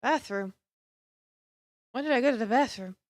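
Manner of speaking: normal talking voice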